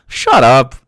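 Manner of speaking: loud, exasperated dismissal; exasperated shout. Real, not acted. Streamer dismissing the video's argument, exasperated shout.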